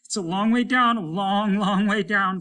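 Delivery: high, loud frightened wail; a frightened crewman drifting away; rising panic. Acted. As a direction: repeat it with mounting fear. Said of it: "said a voice".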